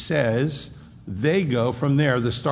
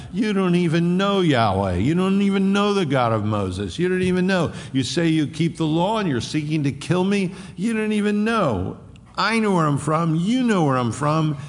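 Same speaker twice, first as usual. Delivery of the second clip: confronting; 6 semitones higher than usual. Acted. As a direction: hammer it at the leaders. Jesus confronting the leaders.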